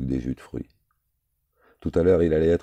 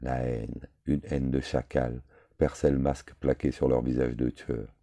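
usual speaking voice

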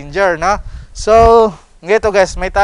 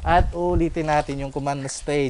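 usual speaking voice

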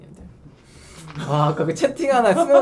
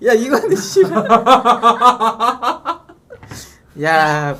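normal talking voice

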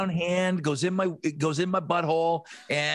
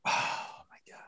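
breathless whisper; soft unvoiced disbelief; whispered oh my god; 8 dB quieter than usual. Real, not acted. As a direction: hush it out in disbelief. Reacting to wild memory.

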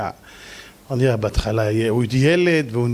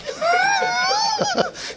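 burst of high laughter. Real, not acted. Laughs at the rooster punchline.

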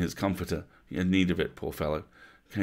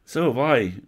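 louder, higher eager answer; cried out; 6 semitones higher, 7 dB louder than usual. Acted. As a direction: blurt it out eagerly. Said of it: "cried Peter".

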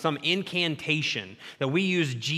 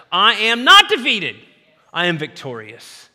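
bold declaration; 8 dB louder than usual. Acted. Declaring a confession of faith.

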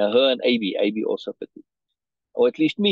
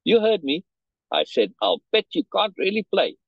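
sarcastic sneer; 7 semitones higher than usual. Acted. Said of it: "He sneered at me and replied sarcastically".

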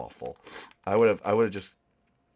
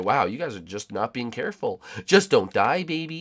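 ordinary talk

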